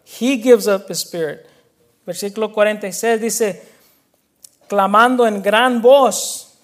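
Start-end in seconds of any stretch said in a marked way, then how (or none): 4.7-6.5 slow and louder on clamando en gran voz; emphatic. Acted. Preacher stressing Jesus cried out to his congregation, slow and emphatic. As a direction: lean hard on each word of clamando en gran voz.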